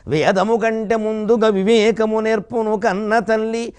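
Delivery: slow, lilting recitation of a verse; poem recital. Shown as a demonstration. Speaker reciting his verse about his mother to the audience, slow recital. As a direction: recite it like a padyam in an assembly, stretching each word. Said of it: "పద్యం చెప్పాల్సి వస్తే".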